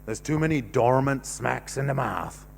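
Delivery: ordinary talk, nothing marked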